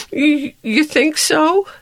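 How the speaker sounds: high, halting sniffly question; a hurt gift hoping for comfort; sniffling. A narrator's reading. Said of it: "sniffled Gift".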